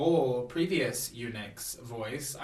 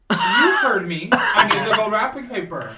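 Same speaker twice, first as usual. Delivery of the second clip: hammed-up haughty concubine, voice raised; imperious insistence; 6 semitones higher, 11 dB louder than usual. Concubine repeats her demand to officials who refused, loudly imperious.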